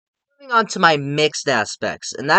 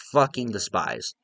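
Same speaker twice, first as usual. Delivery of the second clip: ordinary talk, nothing marked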